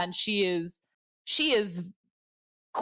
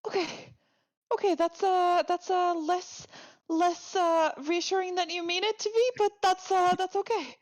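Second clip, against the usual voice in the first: shaky forced calm; 8 semitones higher than usual. Acted. Intern tries to stay calm.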